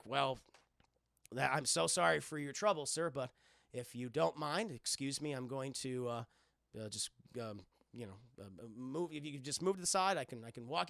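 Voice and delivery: low, soft, halting politeness; polite, hesitant hero. Retold or imitated. Jack asks the stranger to let him pass, politely. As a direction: imitate a courteous hero feeling his way through a request.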